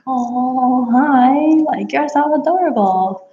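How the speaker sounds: high, drawn-out gushing hello; adoring coo. Retold or imitated. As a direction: coo over an adorable animal. Gushing over the adorable new horse.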